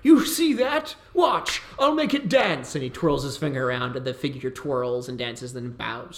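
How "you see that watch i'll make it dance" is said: a much higher, grandly boastful lord; pompous mage. Acted. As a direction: boom out like a vain wizard. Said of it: "He goes".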